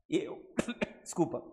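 coughing through a choke; sputtering, throat caught; choking on saliva. Real, not acted. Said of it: "me engasguei aqui".

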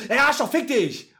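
high, loud outburst of curses; startled swearing. Retold or imitated. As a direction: imitate someone cursing right after a fright. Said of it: "Die sagt selten erschrocken laut".

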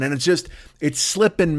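ordinary talk, nothing marked

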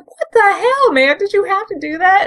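high, incredulous what the hell; mock shocked protest. Bumblebee appalled as Megatron blasts Dreadwing, high incredulous protest. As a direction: play Bumblebee gasping at Megatron.

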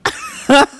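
a high laugh bursting out; burst of laughter. Real, not acted. Caught off guard by a viewer's body count question, high laugh.

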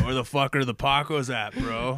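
mock bro demanding beer; brother impression. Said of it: "that's more what he sounds like".

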